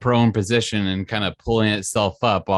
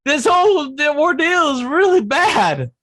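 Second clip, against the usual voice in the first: high, squeaky outraged skeleton voice, shouting at times; indignant skeleton. Acted. Skeleton scolding the party for killing Barry, high squeaky outrage.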